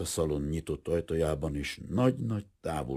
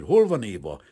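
loud, higher angry question; growled demand; 5 semitones higher, 11 dB louder than usual. A narrator's reading. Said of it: "mordult fel Kazimir".

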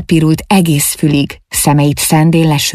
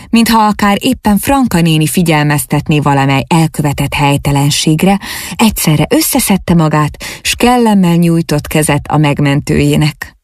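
plain reading voice

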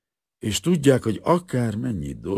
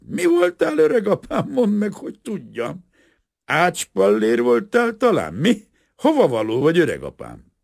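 put-on drunken questioning; 14 semitones higher, 10 dB louder than usual. Drunk carpenter questions the professor.

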